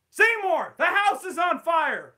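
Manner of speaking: put-on very high, loud, slow old woman; old mother calling. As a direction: call from upstairs in alarm. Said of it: "Steamed Ham script".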